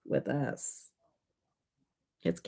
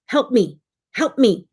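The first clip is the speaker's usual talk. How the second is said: put-on higher, louder little-kid plea; raised voice, insistent little demand; toddler demanding help; 5 semitones higher, 8 dB louder than usual. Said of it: "and she's like".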